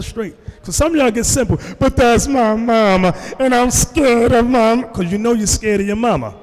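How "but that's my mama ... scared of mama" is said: higher, drawn-out mock whine; mocking, slow plea in a raised pitch; whining mama's child. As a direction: mimic a grown person scared of mama. Mimicking congregants afraid of their mamas.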